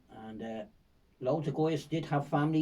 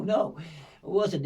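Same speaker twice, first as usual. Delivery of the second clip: usual speaking voice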